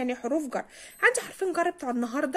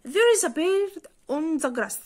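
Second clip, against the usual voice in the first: slow example read; 3 semitones higher, 3 dB louder, much slower than usual. Shown as a demonstration. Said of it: "example sentence read slowly".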